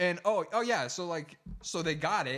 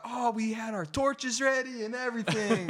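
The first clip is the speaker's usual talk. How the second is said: mock villager; 8 semitones higher, 6 dB louder than usual. Plays villagers reacting to capture.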